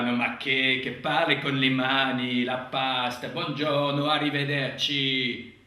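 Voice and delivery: loud hammed-up Italian accent; exaggerated Italian accent, arms waving; mock Italian accent. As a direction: belt it out like a cartoon Italian. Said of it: "l'accent italien".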